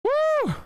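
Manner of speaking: very high drawn-out "woo"; high whoop. Real, not acted. Streamer between chat messages, high whoop.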